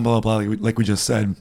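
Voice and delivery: ordinary talk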